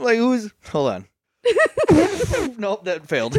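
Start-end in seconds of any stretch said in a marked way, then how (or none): 1.4-2.5 high squealing elephant trumpet; drunk elephant noise. Pretend. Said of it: "Can you do your elephant noise again?".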